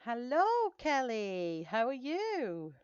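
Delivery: high, bright hello to a viewer, drawn out; sing-song greeting. Real, not acted. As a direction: call out a cheery welcome to Kelly.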